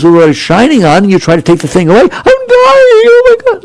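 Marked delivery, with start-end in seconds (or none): 2.0-3.6 high, hammed-up cry of despair; mock dying wail, much higher; mock panic. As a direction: wail it like the world is ending. Mock panic of clinging self.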